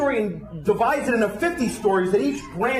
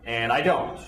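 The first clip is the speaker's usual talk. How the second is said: ordinary delivery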